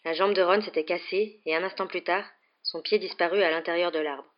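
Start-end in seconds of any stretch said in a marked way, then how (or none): none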